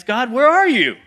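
high, loud cry to heaven; desperate call. Acted. Believer in hard circumstances calling to God, desperate and loud. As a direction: call out as if to someone absent.

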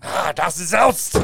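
hammed-up German gibberish; mock German. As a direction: fake German accent, slow and drawn out. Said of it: "it says German".

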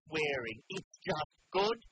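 high, drawn-out mimicry of a patient; deliberate mock announcement with spaced-out last words; announcing to the ward. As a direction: imitate a woman slowly declaring it to everyone. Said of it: "announced to the entire ward".

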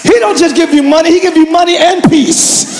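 rising pulpit fervor; fervent preaching. Real, not acted. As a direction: build the list like an impassioned preacher.

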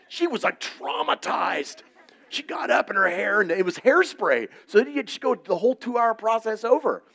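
loud, high, animated storytelling; excited comic retelling. Retold or imitated. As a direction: hype it up for laughs.

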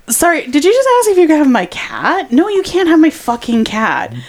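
high-pitched, incredulous comeback; retold indignation. Roommate telling off drunk stranger who wants her cat, indignant. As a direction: act out an outraged refusal, pitch jumping up.

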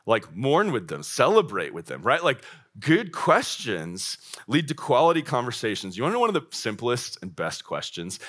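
usual speaking voice

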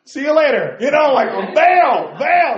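high, loud, mock-panicked getaway shout; hammed-up bail out. Preacher mocking himself fleeing new visitors in panic. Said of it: "And I'm like".

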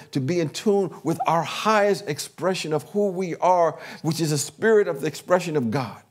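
normal talking voice

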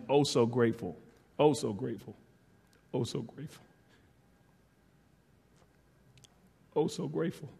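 repeated, fading, choked-up thanks; emotional gratitude. Real, not acted. To his wife and kids, moved near tears. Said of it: "can't look at them without tearing up".

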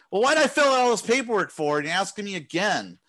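mock exasperation at pointless support questions; exasperated. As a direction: throw it back at them in disbelief. Exasperated retort to support staff.

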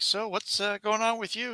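lightly voiced chatty host; talk show host voice. Host asking a cowboy guest about himself. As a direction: ask like a friendly host. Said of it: "It's like".

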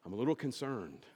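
low, soft, very contained; mock-reserved, barely admitting any upset; understated anger. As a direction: keep the anger tiny and quiet. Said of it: "If they're really angry".

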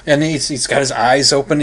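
usual speaking voice

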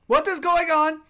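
high, loud in-character outcry; alarmed shout. Acted. Jose demanding answers from Breeze, high loud shout. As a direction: yell it in disbelief.

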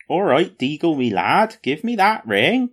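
high, lilting West Country hobbit; West Country accent. Retold or imitated. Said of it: "good West Country accent going on".

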